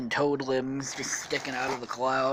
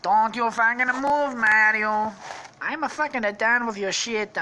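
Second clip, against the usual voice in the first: raised, aggrieved character voice; Luigi, fed up; 6 semitones higher, 4 dB louder than usual. Acted. Jilted brother with a shotgun, angry rant. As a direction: rant as an angry cartoon gunman. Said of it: "He's like".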